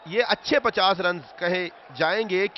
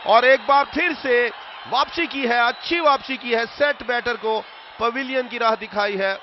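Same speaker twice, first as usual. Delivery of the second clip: loud, higher wicket call; excited cricket commentary; 5 semitones higher, 6 dB louder than usual. Real, not acted. (जोशीली cricket commentary)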